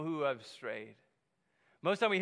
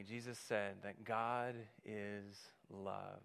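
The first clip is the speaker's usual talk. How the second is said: very slow, soft and lower; slow hushed; 8 semitones lower, 10 dB quieter, much slower than usual. Acted. Preacher slowly leading the congregation to God is love. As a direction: let each word land.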